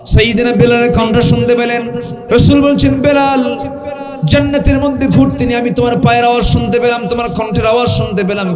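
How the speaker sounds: loud, high-pitched sermon storytelling; full-force preaching. Acted. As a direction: proclaim the story at the top of your preaching voice.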